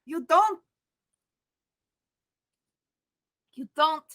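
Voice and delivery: halting, broken pleading; stumbling over words, rattled; shaken. Acted. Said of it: "she's losing it".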